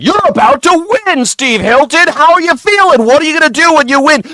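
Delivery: very high, excited squeal of an interviewer; gushing Fox News host. Acted. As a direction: fawn breathlessly over the guest, hammed up. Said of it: "The Fox News is always like".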